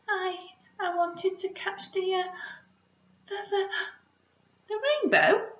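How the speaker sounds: high, hesitant stammering mouse; a shy mouse stumbling over words. Acted. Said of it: "tail blushed".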